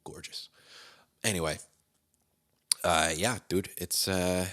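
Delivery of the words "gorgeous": breathy whispered aside. Real, not acted. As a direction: whisper it to yourself. Self-mocking aside after meth joke.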